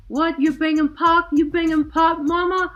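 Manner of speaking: high, loud excited questions; can't believe what you see; excited disbelief. Retold or imitated. Guy amazed she plays [name], loud and high excited disbelief.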